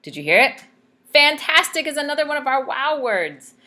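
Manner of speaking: ordinary delivery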